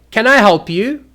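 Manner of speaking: loud, high, impatient challenge; rude. Shown as a demonstration. Showing how intonation offends people, loud and rude. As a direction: snap it out as if bothered. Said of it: "you're being very rude".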